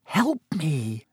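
soft, scared plea; frightened moan.

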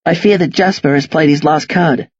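ordinary delivery, nothing marked

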